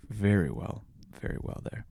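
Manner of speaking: breathy whisper of "Very well there."; conspiratorial whispered tag; whispered aside. Acted. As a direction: drop to a sly whisper. Joke punchline aside.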